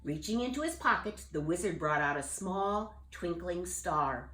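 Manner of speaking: ordinary delivery, nothing marked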